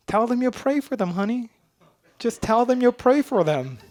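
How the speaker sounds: sweet mom. Retold or imitated. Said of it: "my mom would just be like".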